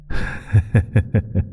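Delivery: a voiceless sly laugh; voiceless sly chuckle; breathy teasing chuckle. Acted. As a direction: laugh like you're keeping a secret. Patron teases hidden powers.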